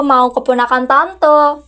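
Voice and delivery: ordinary talk, nothing marked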